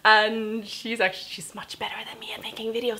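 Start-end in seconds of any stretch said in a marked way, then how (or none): 1.3-3.0 quick secretive whisper; whispered aside. Real, not acted. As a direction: whisper it like a secret the friend mustn't hear. Secret aside about her friend.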